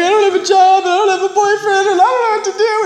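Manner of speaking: high, loud, sing-song sobbing complaint; mock wailing. Crying to the stepmom about losing job and boyfriend, high wail. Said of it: "I'm like".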